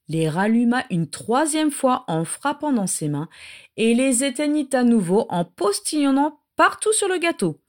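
usual speaking voice

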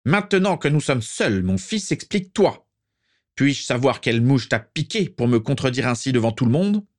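high-pitched angry father; angry outburst. A narrator's reading. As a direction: demand an explanation from your son. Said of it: "pour laisser éclater sa colère". (colère de père, voix plus aiguë)

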